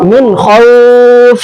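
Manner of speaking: slow, drawn-out খাউফ with the last vowel dropped; stopping on the word. Shown as a demonstration. Teacher showing students the pause, stretched slowly. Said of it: "তখন কি পড়ছি?".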